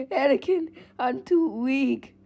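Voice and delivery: put-on high, slow, weak old voice; feeble whine. Palpatine whining to Anakin that he is too weak. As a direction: whimper like you're fading. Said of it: "He's like".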